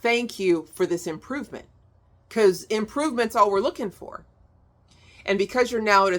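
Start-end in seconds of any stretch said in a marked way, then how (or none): 0.0-1.7 grateful sigh of relief, then thank you; relieved thanks. Shown as a demonstration. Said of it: "stop for a minute and be like".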